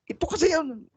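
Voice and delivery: high emphatic delivery; emphasis. Shown as a demonstration. Preacher showing emphasis to the class. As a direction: lift it for emphasis. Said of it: "pagka may emphasis ka".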